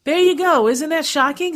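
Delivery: excited exclamation. Real, not acted. Host stunned by the facts.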